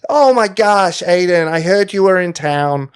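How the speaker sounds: hammed-up Jersey accent; bad Jersey accent. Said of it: "a bad impression of someone with a Jersey accent".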